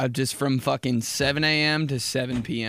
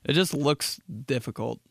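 ordinary talk, nothing marked

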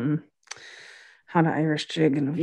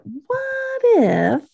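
very high, stretched-out wheedling pitch; acted bargaining; 9 semitones higher than usual. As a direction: float it up high and draw it out. Acts Hot bargaining.

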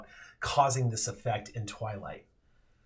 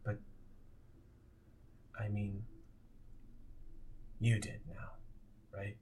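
soft, fading ghost; 3 semitones lower, 12 dB quieter than usual. Acted. Darren, fading ghost, speaks softly.

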